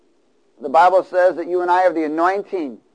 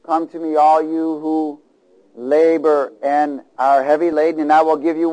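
preaching; 5 dB louder than usual. Acted. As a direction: lean on each word. Quoting Jesus, call and response.

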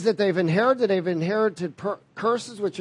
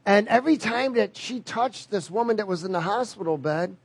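ordinary talk

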